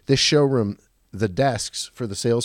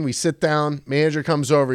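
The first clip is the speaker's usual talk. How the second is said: ordinary talk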